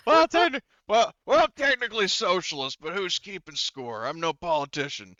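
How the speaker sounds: high, loud comic protest; blustering in character. Acted. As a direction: bluster it loudly, coming out of a laugh. Joking reply in character after laughing.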